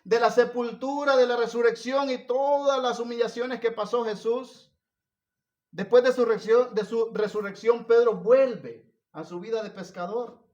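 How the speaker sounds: loud, high-pitched sermon delivery; full-force preaching. Real, not acted. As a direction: preach it out loud to the whole congregation.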